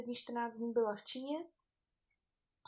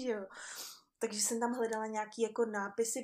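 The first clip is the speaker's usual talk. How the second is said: normal talking voice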